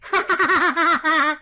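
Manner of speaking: put-on gleeful kid's snicker; mischievous giggle. Boy laughing before hitting the door knocker, mischievous. Boy about to throw snowball.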